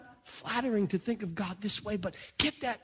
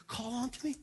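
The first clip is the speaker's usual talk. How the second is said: much softer, gentle plea; soft pleading; 7 dB quieter than usual. Shown as a demonstration. Preacher softly voicing God's invitation. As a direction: hush it like a tender request. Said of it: "God's got a little please at the end".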